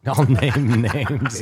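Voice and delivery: slow, weighty "I'll name names"; slow dramatic. Real, not acted. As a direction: drag it out like a threat.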